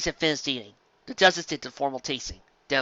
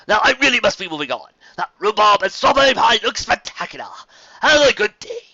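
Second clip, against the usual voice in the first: higher, louder, gracious and flattering; courtly gentleman pony; 7 semitones higher, 7 dB louder than usual. Acted. Fancy Pants flattering Carrot Top, raised and gracious. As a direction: lay the praise on politely.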